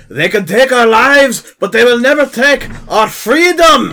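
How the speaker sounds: high, slow, drawn-out shout; rousing battle cry. Acted. Player quoting a war-paint battle speech at the table, rousing shout. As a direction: bellow it like rallying an army.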